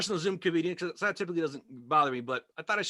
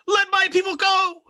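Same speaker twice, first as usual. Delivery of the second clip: high, loud, slow grand proclamation; booming lawyer; 8 dB louder, slower than usual. Retold or imitated. Lawyer booming to the judge, high loud and slow. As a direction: proclaim it like Moses. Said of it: "he went".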